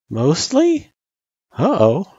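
put-on high, questioning kid's echo; worried echo. Frisk doubting her test result, worried echo. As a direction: echo it back as an anxious question.

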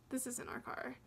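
soft, breathy-hushed dawning shock; hushed realization. Retold or imitated. Realizing in the stranger's car, she says it hushed. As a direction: hush it as the truth sinks in.

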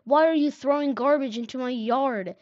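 indignant narrated shout; yelled. A narrator's reading. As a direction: yell it across the yard at the culprits. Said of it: "someone yelled".

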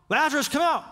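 a quick shouted command; mild mock shout. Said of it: "So he had to shout".

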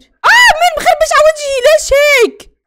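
very high, very loud scream; piercing wail of dismay; shrieking protest. Real, not acted. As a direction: scream in shocked outrage. Shock at her defaced picture.

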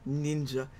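normal talking voice

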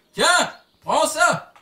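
crié, lent et aigu; cri d'attaque. Real, not acted. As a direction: lance l'attaque en criant fort. Frappe en criant. (cri d'attaque lent et aigu)

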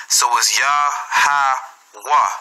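Yah-Ha-Wah spelt out slowly, each syllable apart. Shown as a demonstration. Teacher spelling out Yah-Ha-Wah for students, slow syllables. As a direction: sound out Yah-Ha-Wah piece by piece. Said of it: "the A will be silent".

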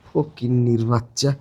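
low, slow, drawn-out scorn; contemptuous insult. Acted. Boyfriend insulting the girlfriend he rejects, contemptuous. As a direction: drop it low and drag out the insult.

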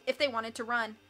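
plain reading voice

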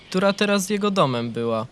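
plain reading voice

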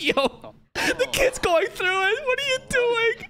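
very high, slow squeal through laughter; imitated disbelief. Viewer voicing a character's shock, high laughing disbelief. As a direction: voice his shock, breaking into laughter. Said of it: "Even he's like".

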